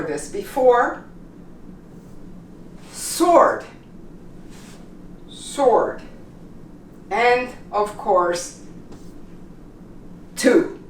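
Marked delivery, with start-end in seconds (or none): 2.9-6.0 Sword as "sord", repeated as a model; silent W. Shown as a demonstration. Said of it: "We don't pronounce the W". 10.4-10.8 two said as "too"; no W sound in two; silent W. Shown as a demonstration. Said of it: "We don't pronounce".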